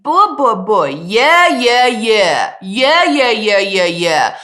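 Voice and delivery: loud chest-voice buh and yeah; chest voice demo. Shown as a demonstration. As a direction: keep it down in the mouth, full. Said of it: "They sound very different".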